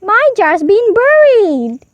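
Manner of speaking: high, loud, slow cry of outrage; hot exclamation. A narrator's reading. Paddington hotly tells the foreman his jar is buried, high loud slow outcry. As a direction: wail it out, hotly indignant. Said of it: "exclaimed Paddington hotly".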